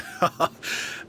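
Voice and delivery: a quiet breathy little laugh; soft chuckle. Acted. Shopkeeper greeting the visitors gives a soft chuckle. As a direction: chuckle it off, a bit sheepish.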